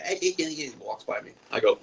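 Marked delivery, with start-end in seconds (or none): 0.1-0.4 high, soft imitated gibberish; mumbled non-words. Bellboy pushing past the guest politely, high soft gibberish. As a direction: mimic his little wordless noise. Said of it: "but he's like".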